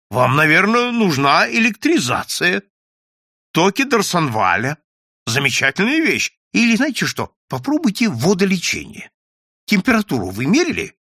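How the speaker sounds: high, lively, insistent recommending; eager advice. A narrator's reading. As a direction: press remedies on them eagerly. Colleague eagerly pushes medical advice.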